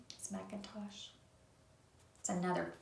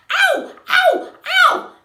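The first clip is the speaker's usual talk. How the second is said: very high, loud yelps; cries of pain; 12 dB louder, slower than usual. Acted. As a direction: yelp like something just bit you. Frog seized by a trout.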